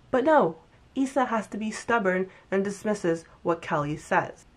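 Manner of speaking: ordinary talk, nothing marked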